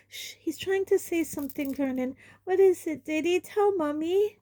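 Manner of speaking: high coaxing mother; urgent hushing coax; mother coaxing son to speak; Aunt Petunia. A narrator's reading.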